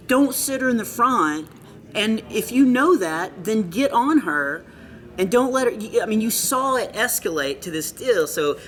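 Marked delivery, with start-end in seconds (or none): 0.1-5.7 firm scolding. Retold or imitated. Scolding youth leader after heckle.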